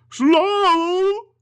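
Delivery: high, loud incredulous ghost; unearthly voice. Acted. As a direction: echo it back in disbelief. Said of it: "the ghost repeated".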